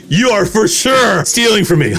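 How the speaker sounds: half-laughing mock confrontation; laughing accusation. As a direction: accuse him while starting to crack up. Acted accusation of roommate, breaking into laughter.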